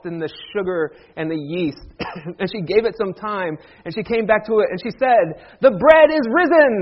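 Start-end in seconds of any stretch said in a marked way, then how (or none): 5.6-6.7 loud, high, grand announcement; hammed-up liturgical call; mock proclamation. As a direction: proclaim bread news like an Easter liturgy. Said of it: "We don't talk like that".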